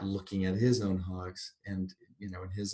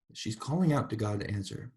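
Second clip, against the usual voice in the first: ordinary delivery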